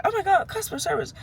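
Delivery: very high, quick put-on squeal of praise; fake-gush in a sky-high voice; rapid hammed-up delight; mock gushing. Mimics gushing praise she disowns.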